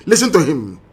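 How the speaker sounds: ordinary talk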